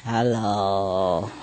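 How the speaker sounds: low, drawn-out greeting; imitated sleepy parrot hello; bird talking in dreams. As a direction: stretch it out low like a sleep-talker. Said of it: "they talk in a dream".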